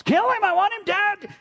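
high-pitched, enraged shout; ballistic yell. Acted. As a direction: explode as a furious ruler screaming an order. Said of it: "goes ballistic and yells".

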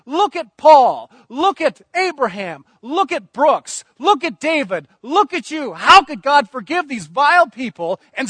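fervent preaching. Acted. Preacher pressing the church on why Jesus prayed, fervent and loud. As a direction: preach it out hard to the congregation.

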